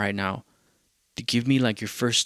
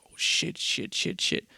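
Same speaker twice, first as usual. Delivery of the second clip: hushed, whispery excitement; breathless-sounding excited muttering, softer than usual; pumped, retold; 6 semitones higher, 4 dB quieter, slower than usual. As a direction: whisper it in rapid bursts, thrilled. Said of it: "I was just getting pumped".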